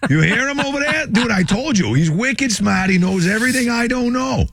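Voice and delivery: high, excited mock Boston accent. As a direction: marvel as a hammed-up Bostonian. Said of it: "put on my Boston accent".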